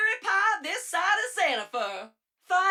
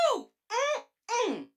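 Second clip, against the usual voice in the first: high, pleased hum of tasting something delicious; an appreciative ooh and mmm; delighted yum; 8 semitones higher than usual. Acted. Savoring a tasty hummus recipe.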